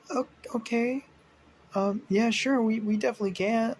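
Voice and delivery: high, hesitant, a bit flustered; nervous agreement. Retold or imitated. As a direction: stammer out a nervous promise. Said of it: "It's like".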